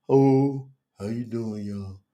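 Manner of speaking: low, dragging, subdued mock hello; mock passionless greeting. Preacher mocking how he never goes live, passionless greeting. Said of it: "ain't no lack of passion in me ever".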